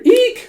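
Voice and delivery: very high, loud "eek"; sudden mock squeal of fright; acted squeal. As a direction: squeal it like a startled heroine. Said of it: "she hears a scream".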